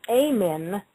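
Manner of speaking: AY-men, long A in front; first vowel of Ammon as in day. Shown as a demonstration. Variant pronunciations of one god's name.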